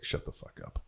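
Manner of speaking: a worn-out dad mumbling him off; hushed, exhausted dismissal; tired dad's brush-off. Retold or imitated. Dad too tired for the poem.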